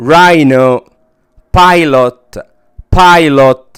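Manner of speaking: dial, lion, bio, rhino, pilot with ai, each said twice; loud repeated model words; teacher drilling ai; long i. Shown as a demonstration. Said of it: "بنطقها long I".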